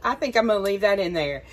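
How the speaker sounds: loud relieved outburst; relieved laughing. Real, not acted. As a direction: blurt it out, half-laughing. Relieved after opening jar.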